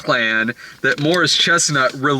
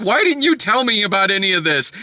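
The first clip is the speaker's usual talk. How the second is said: raised-pitch mock indignation; pissed off; 6 semitones higher, faster than usual. Wife asks husband why he hid it, pissed off. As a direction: imitate an upset wife demanding answers. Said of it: "Regina Hall's pissed".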